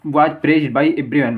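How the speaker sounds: slow example reading. Shown as a demonstration. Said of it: "अब".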